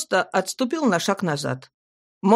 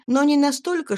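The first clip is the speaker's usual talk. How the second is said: ordinary delivery, nothing marked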